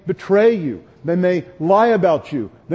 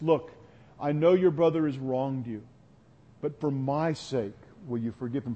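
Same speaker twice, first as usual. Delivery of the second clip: tender pleading mother; 3 semitones lower, 5 dB quieter than usual. Retold or imitated. Pastor voicing a mother begging her hurt son, soft and tender. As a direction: coax him softly for her sake.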